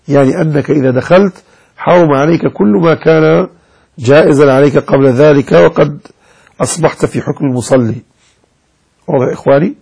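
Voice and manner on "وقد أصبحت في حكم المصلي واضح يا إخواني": ordinary talk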